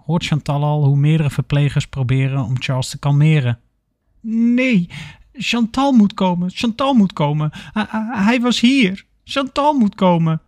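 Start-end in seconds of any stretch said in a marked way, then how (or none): 4.2-10.4 high-pitched, stammering panic; acted panic attack, voice pitched up; panicked. A narrator's reading. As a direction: plead in a high, agitated voice. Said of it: "die heeft een paniekaanval".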